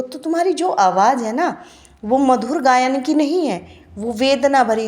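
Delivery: normal talking voice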